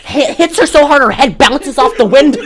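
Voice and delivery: high, loud, laughing through the words; real laughing. Real, not acted. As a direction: barely get it out for laughing. Cracks up retelling the slap.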